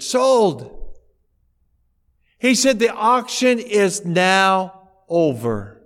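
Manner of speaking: loud, high, slow final call; auctioneer's verdict. Retold or imitated. Auctioneer ending the auction, loud and slow. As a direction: bang it down with the word.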